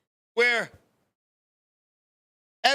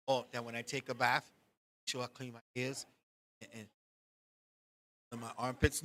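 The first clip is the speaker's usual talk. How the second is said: put-on soft, small, lower voice of a scared kid; timid kid answer; 12 semitones lower, 13 dB quieter than usual. Scared boy answers his angry mother, softly and meekly. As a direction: mumble it out like a boy in trouble.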